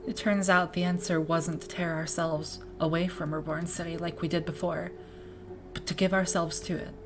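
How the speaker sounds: soft, measured voice with long pauses; reflective academy leader, subdued. Acted. Academy leader reflecting on a missing predecessor, subdued and soft. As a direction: speak quietly and thoughtfully, weighing each thought.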